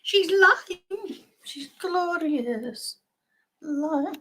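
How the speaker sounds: very high, slow singsong; high singsong alert voice. Retold or imitated. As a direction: chirp it like a cheerful notification. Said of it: "And it'll tell you".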